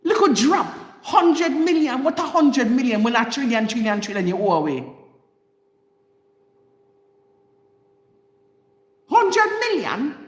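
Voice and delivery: scandalised grandma, starting high and falling away; indignant grandma. Acted. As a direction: let your outraged grandma burst out. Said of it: "that was my grandma coming out in me".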